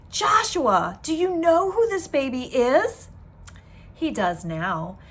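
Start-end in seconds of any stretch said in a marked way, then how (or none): 0.1-3.1 high, bright with astonishment; amazed question. Acted. Shepherds astonished to find the lamb by the baby, higher and louder. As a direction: ask it as if you can't believe it.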